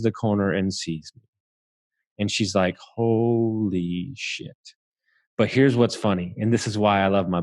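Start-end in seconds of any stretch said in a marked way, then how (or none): 2.8-4.6 very slow, hushed, stunned; stunned gasp. Retold or imitated. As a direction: let it drain out of you in shock. Mom sees his injured face.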